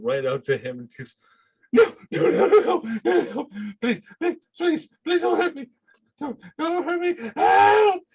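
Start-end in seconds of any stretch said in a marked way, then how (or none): 1.7-8.0 high, loud begging in terror; terrified NPC pleading then shrieking for help; panicked screaming. Acted. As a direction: scream for help at the top of your lungs. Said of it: "He's screaming at the top of his lungs".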